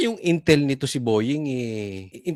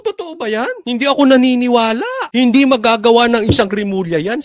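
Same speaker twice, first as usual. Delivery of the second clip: higher, louder mock-indignant protest; mock disbelief; 6 semitones higher, 7 dB louder than usual. Host retelling his own denial to the source, mock indignant. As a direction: ham up the loyal defender, high and loud. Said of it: "Sabi ko".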